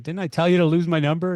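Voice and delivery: imitating an irritated customer; mild mock exasperation; mock annoyed confrontation. Client confronting him.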